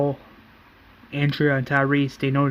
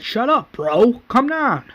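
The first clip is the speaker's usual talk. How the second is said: high-pitched, exasperated mock yell; retold scream; 9 semitones higher, slower than usual. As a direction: cut them off with an acted cry. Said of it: "And then Daryl screams".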